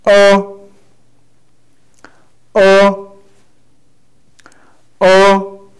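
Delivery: short, loud-edged "O" starting high; falling tone. Shown as a demonstration. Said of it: "you fell down".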